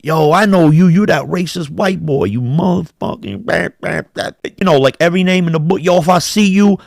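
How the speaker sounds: high-pitched mock threats; retold tirade, pitched well up; imitated going-off rant. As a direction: act out a man going off on someone. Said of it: "he's just going off".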